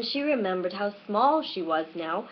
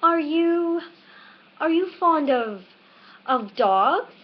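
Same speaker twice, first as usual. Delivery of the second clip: high, louder faltering question; flustered hurry; 6 semitones higher, 4 dB louder, slower than usual. A narrator's reading. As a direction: stumble over it in haste. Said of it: "in a great hurry to change the subject".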